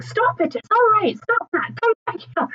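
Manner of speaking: lightly voiced wife shooing dogs back; imitating wife calling the dogs. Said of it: "I could hear lovely wife Helen".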